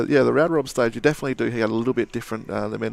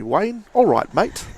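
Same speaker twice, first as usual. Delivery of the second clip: put-on higher, louder, failed Australian accent that slides English; bad Australian accent; 8 semitones higher, 6 dB louder, much slower than usual. Cheerful bad Australian accent greeting viewer Wayne. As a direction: ham up an Australian mate greeting. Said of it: "meant to be an Australian".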